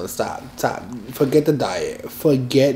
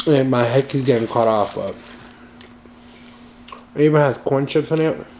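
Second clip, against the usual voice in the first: chewing while talking; eating a burger; mouth full. Real, not acted. Seen 0: Holds bitten burger, chewing motions between lines throughout labelled stretch.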